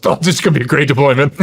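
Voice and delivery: fast, loud excited buddy; excited joking. Retold or imitated. Fellow soldier cheering at the wife's advice, fast and loud.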